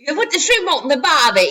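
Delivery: laughing, British-tinged Australian accent; attempted Australian accent. Shown as a demonstration. Teacher testing her accent on her kid, laughing mock Australian that sounds British. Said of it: "that's kinda British".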